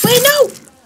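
sudden high panicked no; real startled shout; alarmed cry. Real, not acted. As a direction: yelp as it goes wrong. Pressed the wrong button.

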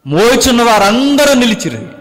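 high, loud preaching of the verse; scripture proclaimed. Acted. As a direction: boom the verse out to the congregation. Repeats verse at full force. (బిగ్గరగా వచనం ప్రకటించడం)

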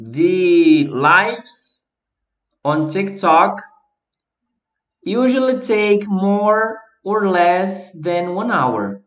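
slow, clear English example read; slow example sentence. Shown as a demonstration. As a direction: model the sentence slowly for learners. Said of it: "Por exemplo".

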